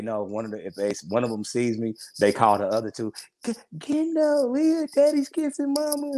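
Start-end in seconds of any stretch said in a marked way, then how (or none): 3.4-6.1 put-on a little kid calling the siblings to come; tattling kid.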